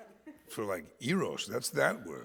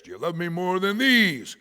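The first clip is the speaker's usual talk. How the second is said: emphatic challenge; 5 semitones higher, 10 dB louder than usual. Acted. Preacher acts out another reading.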